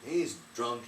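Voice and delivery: plain reading voice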